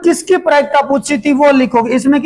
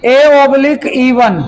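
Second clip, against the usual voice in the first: slow, word by word, as if dictating; slow dictation; much slower than usual. Shown as a demonstration. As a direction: spell it out slowly for the class. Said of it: "मतलब आप ऐसे लिखोगे". (धीरे-धीरे लिखवाते हुए)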